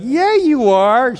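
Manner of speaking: high, loud, slow knowing retort; man of God. Retold or imitated. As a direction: drag it out like you caught her. Man of God catches Sarah laughing.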